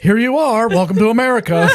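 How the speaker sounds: loud, laughing officer welcome; laughing official. Retold or imitated. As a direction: greet them through laughter. Mock immigration officer greeting.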